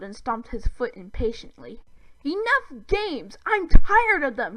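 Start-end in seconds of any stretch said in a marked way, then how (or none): 2.2-4.6 high, loud furious shout; furious alien outburst. A narrator's reading. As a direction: shout your patience is gone. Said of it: "he shouted".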